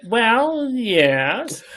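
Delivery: high, loud, stretched-out confession; drawn-out admission. Acted. Daughter answering mother's party question, drawn-out playful admission. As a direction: draw out both words, swooping down from high.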